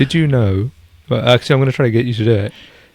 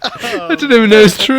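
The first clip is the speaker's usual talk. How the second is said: high and laughing; 11 semitones higher, 3 dB louder than usual. Real, not acted. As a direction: giggle through the confession. Admitting source, laughing.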